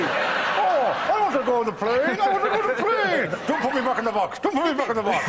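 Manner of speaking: high, laughing ventriloquist-doll pleading; Stephen Hawking as doll. Acted. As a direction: squeak it out like a begging puppet. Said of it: "like a ventriloquist doll".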